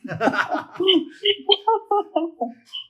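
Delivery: a sudden bright laugh, loud; high burst of laughter. Real, not acted. Teacher laughing at a student's overwhelmed remark, bright laugh. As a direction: laugh it off warmly. Student says so much information.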